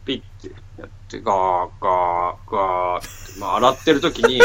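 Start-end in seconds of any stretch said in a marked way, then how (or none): 1.2-3.3 low, drawn-out machine noise; low mechanical droning; imitated washing machine rumble. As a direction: imitate a washer churning, low and slow.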